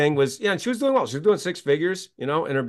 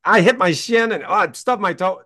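mock stumbling pain; 6 semitones higher than usual. Acting stumbling in the dark.

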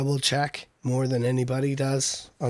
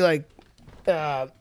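trailing off. Real, not acted. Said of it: "I think I lost my train of thought there".

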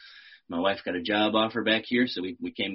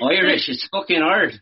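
attempted Irish accent, louder and higher; 10 semitones higher, 8 dB louder than usual. Shown as a demonstration. Actor showing how hard Irish is, in an Irish accent. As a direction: have a go at a broad Irish accent. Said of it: "man, Irish is so hard".

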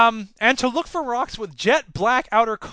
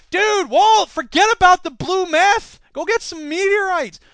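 excited call to Walt; 10 semitones higher, 5 dB louder than usual. Acted. Addressing Walt from Breaking Bad.

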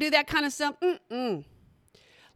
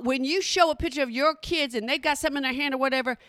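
usual speaking voice

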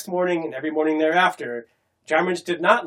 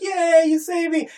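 high, slow, sing-song thanks; mock cheerful girl; 12 semitones higher, 4 dB louder, slower than usual. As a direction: play the delighted rescued girl. Girl voiced cheering her rescuer.

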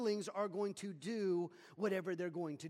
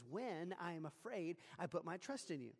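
softer, quieter reading of David's words; hushed confession; 4 semitones lower, 7 dB quieter than usual. Shown as a demonstration. Preacher rereads the psalm as frightened David, hushed. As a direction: let the verse drop to a soft, humble admission. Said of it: "even David finds himself afraid".

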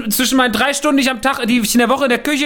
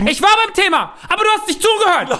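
mock argument; 9 semitones higher than usual. Gespielter Streit.

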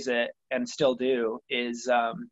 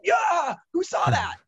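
high, loud, whooping celebration; acted burst of giddy triumph; triumphant shout; 4 dB louder, slower than usual. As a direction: crow like a kid who just landed a trick. Skater kid finally lands the trick.

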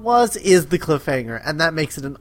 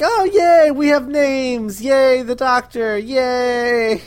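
mock Dalek cheer; 7 semitones higher, slower than usual. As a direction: cheer like delighted toy robots. Daleks voiced cheering childishly.

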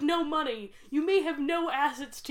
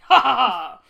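villain laugh; 16 dB louder than usual. Pretend. Mock emperor proclaims himself.

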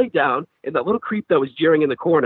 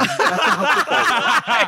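high, slow, laughing through the words; laughing director; 14 semitones higher, slower than usual. Retold or imitated. Director laughing at his football score, high and slow. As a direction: crack up while correcting him. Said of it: "the director busts out laughing".

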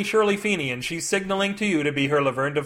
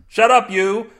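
angry snap; 5 semitones higher, 6 dB louder, slower than usual. Acted. Angry retort in vegetable brawl.

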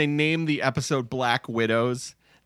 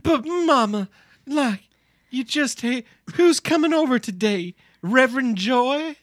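high, hammed-up Southern belle drawl; a mock Southern daughter pleading with Mama; 9 semitones higher than usual. Daughter teasing Mama in a hammed-up Southern drawl. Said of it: "doing her best Southern drawls".

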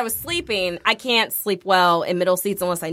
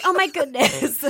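higher, drawn-out gush; mock gushing; 4 semitones higher, slower than usual. Retelling a fellow passenger's reaction, mock gushing. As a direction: imitate an overly delighted woman, slowly.

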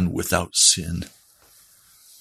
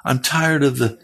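higher, urgent preacher's call; fervent preaching; 7 semitones higher than usual. Acted. Preacher urging listeners toward the Lord, fervent and raised. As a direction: lift it like a revival sermon.

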